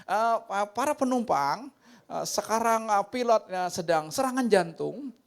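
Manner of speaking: hammed-up nervous captain to passengers; mock pilot announcement. Pilot addressing passengers mid-emergency, stammering and loud.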